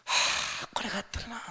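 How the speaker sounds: big sigh, then soft high-pitched regret; grudging giver. Acted. Giver reluctant to part with money, grudging sigh. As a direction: sigh wistfully over what you give up. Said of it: "惜しみながらする人なんです".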